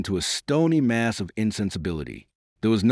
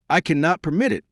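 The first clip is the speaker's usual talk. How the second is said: firm, higher-pitched icy refusal; 8 semitones higher, 3 dB louder than usual. A narrator's reading. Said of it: "I answered icily".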